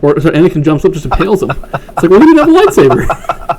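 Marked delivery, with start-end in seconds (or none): 2.1-3.6 high, loud, laughing retort; laughing mock protest. Joking about Anakin's unfair win, a laughing high protest.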